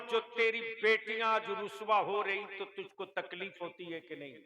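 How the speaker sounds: ordinary delivery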